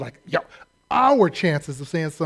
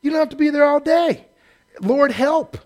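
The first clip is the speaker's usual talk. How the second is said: higher, louder, exasperated; exasperated Lord; 9 semitones higher, 4 dB louder than usual. Shown as a demonstration. The Lord telling long-winded prayers to wrap it up, exasperated and higher. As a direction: throw up your hands at the long prayer. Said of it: "the Lord is kinda saying".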